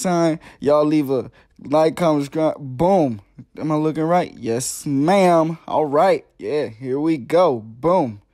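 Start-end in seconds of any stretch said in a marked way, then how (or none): none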